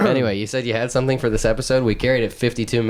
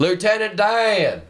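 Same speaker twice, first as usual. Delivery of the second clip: high, slow call as Forrest Gump; calling Lieutenant Dan; 12 semitones higher, slower than usual. Retold or imitated. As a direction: call out to Lieutenant Dan, stretched and much higher.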